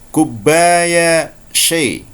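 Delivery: very slow, drawn-out Egyptian Arabic request; Egyptian Arabic accent. Shown as a demonstration. Teacher showing the Egyptian way to order tea. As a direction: ask for tea the Egyptian way, slowly. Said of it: "orang Mesir akan mengatakan".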